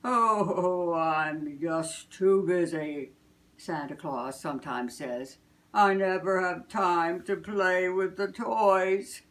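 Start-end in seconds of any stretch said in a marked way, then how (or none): 0.0-3.1 slow, louder sighing Santa. Acted. Santa complaining to himself, slow and loud. 5.7-9.2 Santa voice. Acted. Santa's spoken line.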